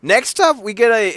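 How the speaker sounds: high, loud announcer lift; hyped segment opener. Real, not acted. Host to co-host introducing the next match, hyped up. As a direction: sell the next topic like a showman.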